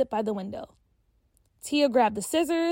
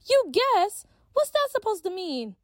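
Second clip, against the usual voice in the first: put-on high, incredulous girl voice; indignant; 10 semitones higher, 4 dB louder than usual. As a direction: Peach taken aback, demanding an answer. Peach indignant.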